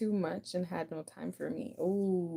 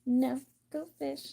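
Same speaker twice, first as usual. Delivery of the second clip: mock go fish reply; 7 semitones higher, slower than usual. Acting out a go fish game.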